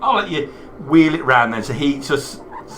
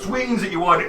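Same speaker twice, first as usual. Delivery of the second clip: ordinary talk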